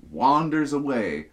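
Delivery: ordinary talk, nothing marked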